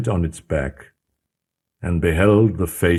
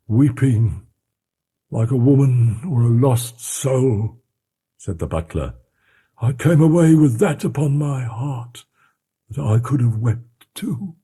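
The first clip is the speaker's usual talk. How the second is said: higher, hushed-urgent servant recalling horror; a frightened butler confessing the dread he felt; shaken butler; 7 semitones higher, 3 dB louder than usual. Acted. Poole recalls the weeping.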